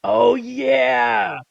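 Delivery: loud, high, eager outburst; excited shout. Real, not acted. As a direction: shout with sudden delight. Sudden recall.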